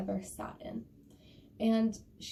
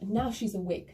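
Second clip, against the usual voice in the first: usual speaking voice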